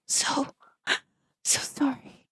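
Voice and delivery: voiceless whisper; whispered apology. Real, not acted. Player whispers an apology for interrupting, whispered. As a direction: hush the sorry. Apology after talking over reading.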